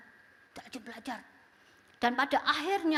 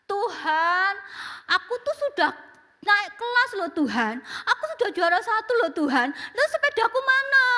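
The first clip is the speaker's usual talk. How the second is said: put-on high pleading child praying; child's prayer; 5 semitones higher, 6 dB louder than usual. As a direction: beg God like a small boy. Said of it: "dia berdoa". (doa anak yang memelas)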